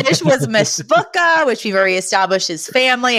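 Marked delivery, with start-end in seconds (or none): 0.5-1.5 Mishpucha said high with a spitting push on the sounds; Mishpucha, high and spat; Sylvia's Mishpucha. Retold or imitated. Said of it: "whenever Sylvia says it, she spits".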